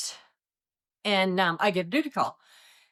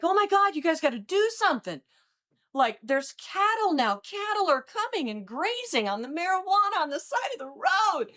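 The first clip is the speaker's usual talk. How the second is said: high-pitched frantic caller; mock panic, higher than his own voice; panicked caller; 13 semitones higher than usual. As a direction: pile on the fake alarm, pitched way up. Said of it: "He's like".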